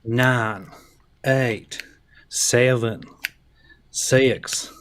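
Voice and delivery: drawled Southern countdown; put-on Southern accent. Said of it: "It's my southern accent".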